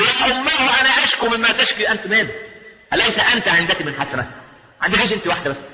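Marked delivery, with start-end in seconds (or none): 0.0-2.3 higher-pitched protesting reply; son answering mother. Acted. Son refusing his mother on Judgment Day, pleading high. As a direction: plead back, voice lifted high. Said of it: "يقول".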